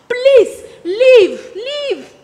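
loud, high plea to go; exasperated, emphatic dismissal; insistent demand. Acted. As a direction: push out each word forcefully, then ease off. Ordering an unwelcome sister to go.

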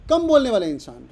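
कम बोलने वाला इंसान called out high and loud; loud emphatic definition. Shown as a demonstration. Teacher calling out the meaning to the class, loudly. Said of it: "reticent".

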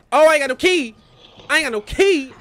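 high, loud cry of alarm; panicked shout. Real, not acted. Player finding he has no key, panicked shout. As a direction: yell it out, pitched high.

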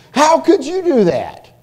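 high, loud reproachful question; hurt disbelief. Acted. Confronting someone close who let you down, incredulous. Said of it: "had to say to that person".

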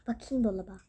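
whispered to self; a quiet whisper at the fridge. Acted. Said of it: "whisper heard". (fısıltıyla)

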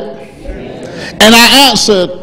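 slow, drawn-out pulpit reading; preaching at full force. Acted. Preacher reading Acts to the church, drawn out and loud. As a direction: preach the scripture big and slow.

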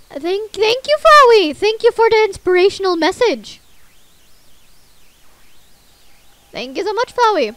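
high, loud overdone gratitude; mock-thank him at full volume; loud sarcastic thanks. Real, not acted. Player loudly mock-thanking creepy Flowey.

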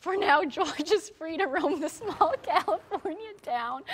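slow, breaking into laughter mid-line; trying to read through giggles; laughing. Real, not acted. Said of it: "I'm gonna try to get through this story".

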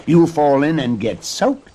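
put-on girl's cautioning call. A narrator's reading. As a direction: call the warning over to someone. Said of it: "Rose Red called".

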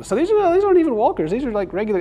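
high, loud surprised outburst; excited surprise. Real, not acted. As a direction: blurt it out amazed.

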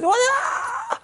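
a high mock wail, "Wah!"; mock freak-out cry. Coach acting out a woman freaking out, high mock wail. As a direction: imitate her sudden outburst with a high yelp. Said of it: "She says".

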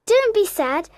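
put-on high, encouraging girl's voice; consoling Wendy. Wendy inviting the lost boys to London, consoling. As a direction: cheer them up, pitched high.

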